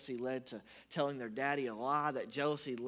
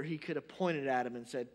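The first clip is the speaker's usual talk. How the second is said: ordinary delivery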